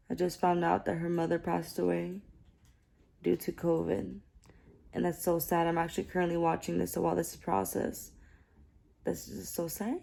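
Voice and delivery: soft and somber; hushed sympathy; sad. Real, not acted. News of a death.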